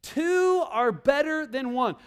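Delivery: reading the memory verse out slowly; slow, deliberate scripture reading; slow recitation. Shown as a demonstration. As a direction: spell out each word of the verse. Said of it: "I love how practical".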